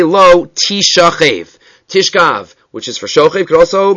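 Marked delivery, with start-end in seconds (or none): 0.0-1.6 Tishachev drawn out slowly, syllable by syllable; "Tishachev" slow and deliberate, each vowel clear; alternate reading. Shown as a demonstration. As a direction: spell out "Tishachev" slowly as ti-sha-CHEV. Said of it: "Kari Bei".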